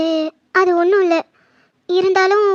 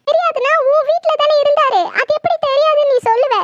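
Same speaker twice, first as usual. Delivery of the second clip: higher, sharp accusing outburst; accusing her sister-in-law, higher and angry; angry accusation; 5 semitones higher than usual. Acted. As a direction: throw the blame at her. Widow angrily confronts sister-in-law.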